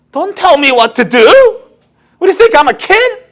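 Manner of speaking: slow, loud, high defiant retort; defiant grown child. Retold or imitated. Grown child snapping back at parents, slow and loud. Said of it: "이러는 거예요".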